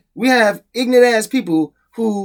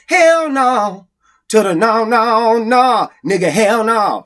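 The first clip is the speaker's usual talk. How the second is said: loud, high, drawn-out outburst of no; emphatic refusal; 4 semitones higher, 5 dB louder, much slower than usual. Real, not acted. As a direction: belt out each no in outraged disbelief. Emphatic answer to own question.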